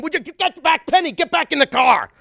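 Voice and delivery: high, loud angry shout; dad screaming. Retold or imitated. Father yells at wife Penny to get back in the car, loud high shout. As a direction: yell at her to get back in. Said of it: "while my dad would scream".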